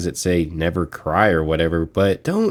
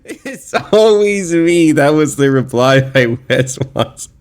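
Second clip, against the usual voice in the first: laughing through the words, climbing higher; 3 semitones higher than usual. Real, not acted. Vlogger clowning a fan's clip, laughing. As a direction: crack up while repeating the line. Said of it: "I'm laughing at this guy".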